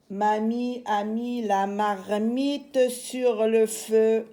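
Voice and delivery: slow, every syllable stretched out; drawn-out model sentence, silent endings sounded; slow syllabic recitation. Shown as a demonstration. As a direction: recite it slowly for young readers to follow. Teacher recites a practice sentence.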